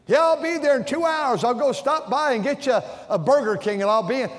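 high, loud imitation of a man on the phone; imitating caller. Driver promising Burger King to someone in hospital, mimicked high and loud. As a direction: rattle it off like a busy driver on the phone.

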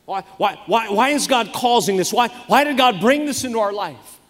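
a hint of a whiny child; whiny kid asking why. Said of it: "we shouldn't say".

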